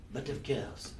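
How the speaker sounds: put-on a French-accented repeat of the line; lightly mocking French accent. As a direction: say it again like a Frenchman. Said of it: "Wait, that was a French accent".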